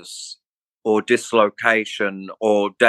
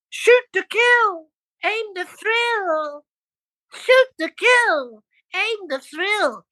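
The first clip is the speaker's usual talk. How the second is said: high, slow squeaky cartoon singing; slower than usual. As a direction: sing it like a cartoon character. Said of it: "In this cartoon voice".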